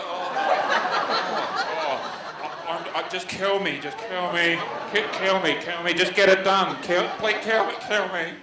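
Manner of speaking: a hint of a terrified victim pleading; mock begging for death. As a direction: beg to be killed, broken and resigned.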